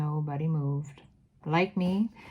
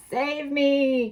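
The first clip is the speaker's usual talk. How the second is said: high, loud plea for help; terrified cry; 8 semitones higher, 6 dB louder than usual. Acted. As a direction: scream for rescue. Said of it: "cried the wretched witch".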